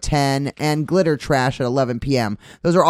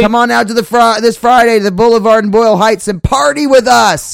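high, loud, excited invitation; rousing shouted plug; 7 semitones higher, 7 dB louder than usual. Real, not acted. Host urging listeners to Friday's show, excited shout. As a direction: holler the invite to everyone listening.

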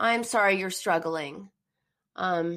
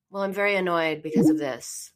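very flat, lower-pitched delivery; monotone; 4 semitones lower than usual. Shown as a demonstration. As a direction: state the annoyance with no feeling. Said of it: "Like, very monotone, very flat".

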